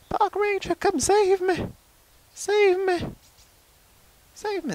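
very high, pleading cry for help; pleading call. Pretend. Chicken begging the park ranger to save it, very high. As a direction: beg the ranger to come, very high.

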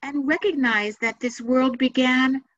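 measured meditation guide, long pauses between phrases; unhurried guided-meditation delivery with room to breathe. Acted. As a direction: lead listeners gently through it, phrase by phrase.

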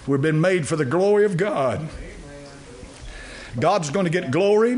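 ordinary delivery

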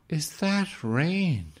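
put-on high, slow, worried old lady's question. Old lady hearing rain on the roof, high and slow. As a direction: stretch it out high like a nervous old woman.

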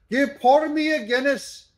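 high hammed-up stage-Irish brogue; mock Irish accent. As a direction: ham up a pub-order in Irish brogue. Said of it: "That's Joe Scarborough making fun of every Irish".

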